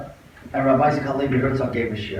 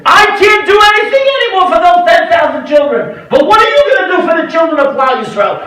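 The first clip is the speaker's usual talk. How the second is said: high, loud preaching charge; fiery exhortation; 13 semitones higher, 10 dB louder than usual. Retold or imitated. Rabbi charging the yeshiva boys to save children, shouting. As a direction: hammer the challenge at the boys.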